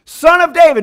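loud pleading cry of Son of David, rising high. Retold or imitated. Blind men calling out to Jesus, a loud plea. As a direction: call out to the passing king for mercy. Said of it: "And they said".

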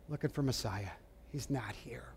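softly, fading into a whisper; Mary's hushed, grieving answer at the empty tomb. Retold or imitated. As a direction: let the words break down to breath. Mary answers the angels at tomb.

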